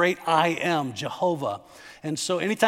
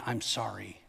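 shallow apology; 9 semitones lower, 12 dB quieter than usual. Retold or imitated. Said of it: "very different than simply saying".

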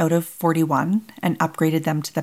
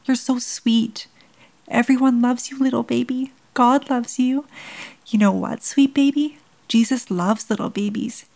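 tearful farewell; 6 semitones higher than usual. Acted. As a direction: pour love into it through tears. Mother talks to dying baby.

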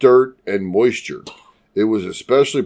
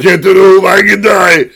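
put-on loud, high action-hero shout in Austrian accent; Schwarzenegger impression; 5 semitones higher, 8 dB louder than usual. Co-host spoofing an action-movie line, mock Schwarzenegger shout. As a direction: bellow it like an Austrian action star. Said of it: "Oh, that's Schwarzenegger".

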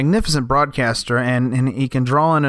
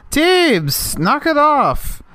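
much higher, slow scolding call; 13 semitones higher, much slower than usual. Real, not acted. Scolding the cat.

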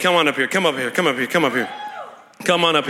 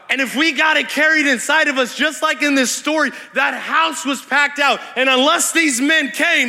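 raised, driving pulpit voice; impassioned preaching; 4 semitones higher than usual. Real, not acted. Preacher urges the church to pull on healing power, impassioned. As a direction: preach it high and insistent, hitting key words.

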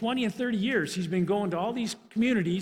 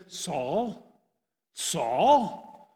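voice from heaven; 3 semitones lower than usual. Acted. Voice from heaven calls.

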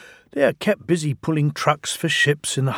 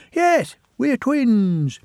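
high, slow gloating engines; triumphant twins; 11 semitones higher, 3 dB louder, much slower than usual. Acted. The twins revealing themselves to the diesel, high and slow. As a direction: savour the reveal slowly.

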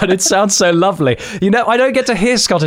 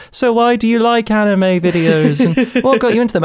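higher-pitched stock interviewer voice; mock interviewer; 6 semitones higher than usual. Host parodying the usual interview questions, mock interviewer voice. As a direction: put on a generic interviewer's questioning voice.

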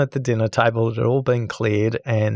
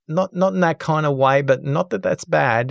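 high, hurried no no no; flustered denial; 7 semitones higher than usual. Retold or imitated. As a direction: scramble to correct her, higher. Husband hastily denying affair misunderstanding.

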